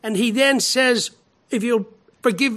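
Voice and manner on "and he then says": ordinary delivery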